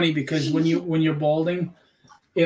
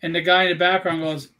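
usual speaking voice

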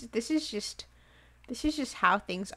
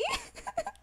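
high giggle; 7 dB quieter than usual. Real, not acted.

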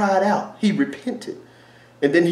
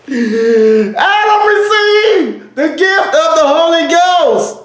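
much higher and louder, slow, drawn out; preaching shout; 10 semitones higher, 5 dB louder, much slower than usual. Real, not acted. Preacher shouting the climax to the congregation, slow and drawn out. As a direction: cry it out at the climax.

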